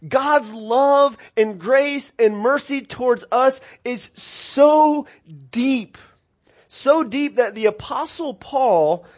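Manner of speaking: fervent preaching. Acted. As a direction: lift the congregation with each word. Preaching climax on God's love.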